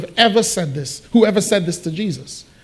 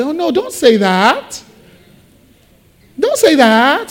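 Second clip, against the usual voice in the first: high, drawn-out mock pleading; dismayed protest; 6 semitones higher than usual. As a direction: protest with a rising, stretched last word. Imitates people protesting a grim remark.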